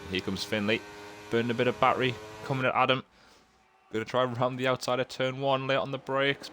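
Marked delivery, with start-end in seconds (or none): 1.3-6.5 excited commentary. Real, not acted. Overtake attempt, rising commentary.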